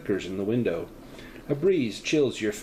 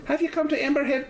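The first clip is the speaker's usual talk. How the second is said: put-on higher, faster retry still stuck in a Scottish accent; faster than usual. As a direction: try again, Scottish lilt won't shake off. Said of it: "now I'm stuck in a Scottish one".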